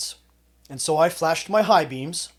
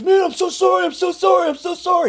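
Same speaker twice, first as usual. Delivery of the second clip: imitating a whimpering plea, much higher and louder; mock crying apology; 10 dB louder than usual. Pinned tough guy begging the narrator, mock crying plea. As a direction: play him begging, near tears. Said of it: "And he started crying".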